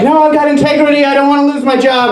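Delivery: high, protesting inner voice; conscience voice. Acted. Teacher's conscience arguing against swearing, high-pitched protest. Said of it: "the other voice in my head".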